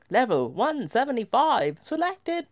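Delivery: high-pitched British lift announcement; British elevator voice. Retold or imitated. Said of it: "I don't know why it was British".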